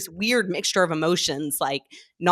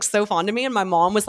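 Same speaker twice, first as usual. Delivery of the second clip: ordinary delivery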